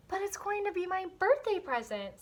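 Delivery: put-on high pleading little girl; pleading child.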